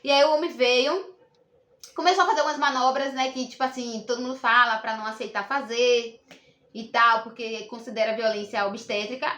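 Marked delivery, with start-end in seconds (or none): none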